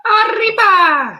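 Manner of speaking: high, drawn-out festive cry; mock cheer. Host egging Anne on with a mock cheer. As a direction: cheer it like a fiesta.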